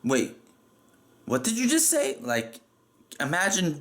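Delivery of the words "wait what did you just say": hammed-up shock, pitch leaping up on the question; overdramatic disbelief. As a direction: pause on "wait", then mock-incredulous high question. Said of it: "over dramatically".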